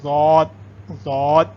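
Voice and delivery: ضاد twice, heavy d and long vowel; correct pronunciation. Shown as a demonstration. Teacher drills the right form after the errors, heavy drawn-out ضاد. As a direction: repeat ضاد slowly with a thick d. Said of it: "دونوں نہیں ہے".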